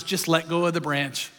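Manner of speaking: low, lightly played divine instruction; a hint of a deep-voiced God; God's voice. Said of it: "And God says".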